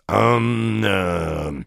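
drawn-out hemming, sinking in pitch; важный председатель. A narrator's reading. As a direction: hem like a ponderous chairman. Chairman's hemming pronouncement. (важное начальственное мычание)

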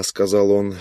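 ordinary delivery, nothing marked

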